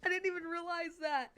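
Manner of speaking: high laugh into the words; laughing at own slip. Real, not acted. Speaker laughing after telling the bug story, real laugh.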